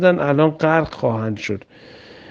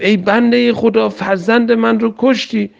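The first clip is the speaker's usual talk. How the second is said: mother's anguished cry; 6 semitones higher than usual. Acted. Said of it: "مادر جیغش به آسمان بلند شد".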